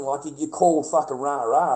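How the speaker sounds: ordinary delivery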